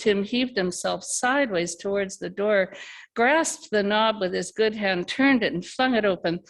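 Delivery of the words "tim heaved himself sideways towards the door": usual speaking voice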